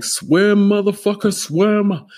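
high, loud mocking yell; mock shout. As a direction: bark it at the kid in the water, high and loud. Pusher shouting at kid in pool.

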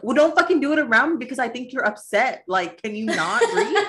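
over-dramatic irritation; hammed-up angry retort. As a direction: re-enact snapping at a partner, played for comedy. Retold quarrel, hammed up.

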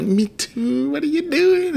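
very high, sing-song sweet voice; mock lovestruck. Husband mimics himself swooning on the phone. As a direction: gush it up high, all smitten.

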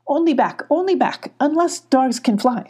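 high fussy goat voice; Billy the goat fretting. A narrator's reading. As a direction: goat shaking his head. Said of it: "said Billy".

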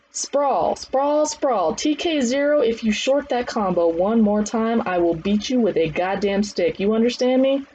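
high drill calls turning to threat; trainer's stern calls. Acted. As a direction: ride the trainee hard.

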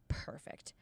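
whispered aside to oneself; hushed. Retold or imitated. Storyteller relishing a lucky tip, whispered. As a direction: whisper it under the breath. Retold private reaction to good news.

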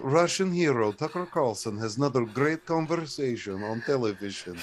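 put-on Russian state TV announcer accent. Parody Russian TV presenter praising a host, Russian accent. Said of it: "on Russian T V".

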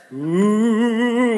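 long, drawn-out ghost moan; ghostly "ooh". Pretend. Youth preacher playing a ghost haunting people, spooky ooh. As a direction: wail like a pretend haunting ghost.

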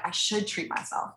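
hushed, breathy aside to herself; sneaky little whisper; whispered self-talk. Retold or imitated. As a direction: whisper it as if tempted.

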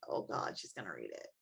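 retold inner thought, whispered low and fading; dreading that mom will read the book, whispered. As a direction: hush it like a panicked aside to yourself. Retelling dread before mom reads book.

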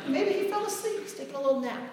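very high, sing-song mock concern; sarcastic taunt. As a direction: tease them in a tiny high mocking voice. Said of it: "a great sarcastic tone".

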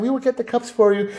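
very high mock-girly voice; feminine voice. Manager answering a customer's request, high feminine voice. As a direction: imitate the manager, pitched right up. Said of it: "like, feminine voice again".

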